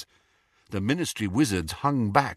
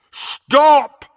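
loud booming stop; thundering shout; 10 dB louder than usual. A narrator's reading. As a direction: thunder it across the hall. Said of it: "Harry thundered".

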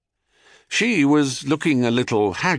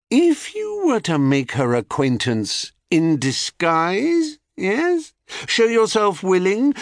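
high, coaxing, lilting voice; scheming goddess; 6 semitones higher than usual. Acted. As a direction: dangle the wicked idea in a raised, teasing voice. Selene coaxing Cephalus into a test.

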